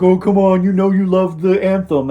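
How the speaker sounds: mock the friend egging him on; imitating his buddy. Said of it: "my buddy was like".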